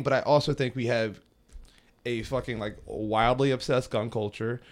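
ordinary talk

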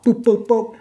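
three high little boops; quick high-pitched boop sounds; vocal blips ticking things off; imitated blips. Imitating checking ships off.

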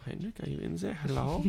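soft, tentative German accent. Shown as a demonstration. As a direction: call quietly with a mock German accent.

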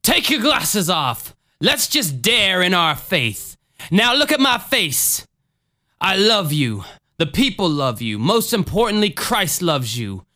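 slow, high-swooping preacher voice; Jim Jones. Acted. Preacher heals a lady wearing glasses, slow theatrical voice. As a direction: draw out each phrase like a faith healer. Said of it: "Jim Jones".

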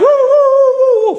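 very high, loud, drawn-out siren hoot; imitated siren wail. Narrator imitating the vehicle's siren being switched on, imitated wail.

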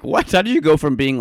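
incredulous. Retold or imitated. Retelling disbelief at cousin's change.

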